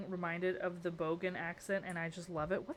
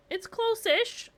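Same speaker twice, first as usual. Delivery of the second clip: squeaky doubt; 14 semitones higher, 6 dB louder, slower than usual. Real, not acted. Unsure about the build.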